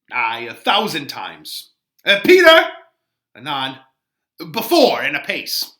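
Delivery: loud, high call to the servant; nurse calling Peter. Acted. Nurse calling her servant Peter, loud and high.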